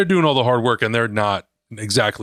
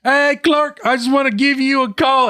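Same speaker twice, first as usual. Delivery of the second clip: hammed-up mom shouting into a phone; mock phone yelling; 11 semitones higher than usual. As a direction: shout down the line like a mom who thinks phones need volume. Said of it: "she's, like, screaming into the phone".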